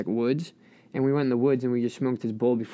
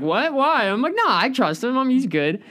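high, loud naive protest; high-pitched surprise; 9 semitones higher, 3 dB louder than usual. Retold or imitated. First-timer defending his friend to the group, high and surprised. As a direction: squeak out a confused defence.

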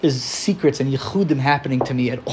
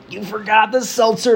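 wife's reproach; 6 semitones higher than usual. Retold or imitated. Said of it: "your wife's like".

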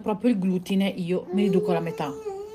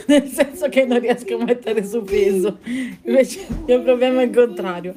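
laughing; 4 semitones higher, 7 dB louder than usual. Real, not acted. Laughs about her own weight.

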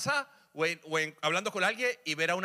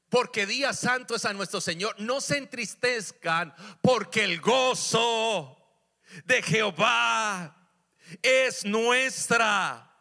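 slow, very loud, high preaching; preacher leading the church in a shouted declaration; shouted proclamation; 6 semitones higher, 6 dB louder, much slower than usual. Acted. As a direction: build to a full shout on fortaleza. Climax of sermon, shouted.

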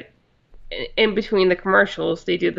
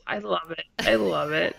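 laughing; 4 semitones lower, 5 dB quieter than usual. Real, not acted. Delighted laugh.

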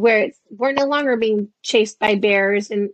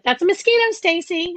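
lightly voiced husband, pitched up; higher, pointed reminder to his wife; imitating a husband's chiding; 7 semitones higher than usual.

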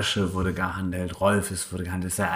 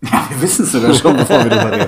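lachend und laut gesprochen; laughing; 4 semitones higher, 11 dB louder, faster than usual. Real, not acted. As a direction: durch Lachen hindurch reden. Laughing about known tip. (lachend, deutlich lauter)

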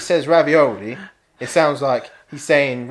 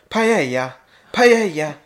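paella repeated, rising higher and louder; hammed-up Spanish paella; mock Spanish paella; 7 semitones higher, 5 dB louder, slower than usual. Mocking TV cooks teaching the table, Spanish accent. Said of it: "they just kept going".

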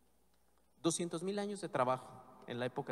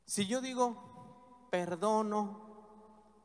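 "Perdono" drawn out slowly in an Italian accent, pitched higher; 6 semitones higher, 5 dB louder, much slower than usual. Shown as a demonstration. Priest showing the congregation the Italian word, slow Italian accent. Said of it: "En el acento".

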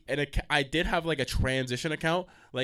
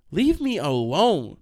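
loud, slow, stretched-out exasperation; exasperated; 3 semitones higher, 7 dB louder, much slower than usual. Retold or imitated. TikTok guy to clingy classmates, slow loud exasperation. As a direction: drag out ALONE in a loud protest. Rejecting the hangers-on, first take.

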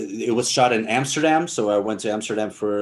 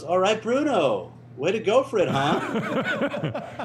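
slow, higher, laughing through the words; laughing quote; 7 semitones higher, much slower than usual. Retold or imitated. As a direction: retell it cracking up. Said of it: "he goes".

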